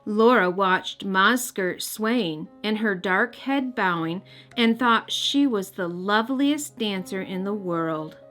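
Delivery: usual speaking voice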